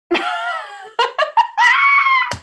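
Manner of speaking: high shrieking laugh. Real, not acted. Player laughs loudly.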